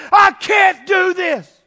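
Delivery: high, loud, slow cry of despair; Mary screaming. Pretend. Mary in labor screaming, high and loud. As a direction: cry out that she can't go on. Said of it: "She's probably saying".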